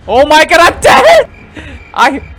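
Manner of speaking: very high, loud panicked shriek; panicked scream. Real, not acted. Gamer caught by the chasing monster, panicked scream.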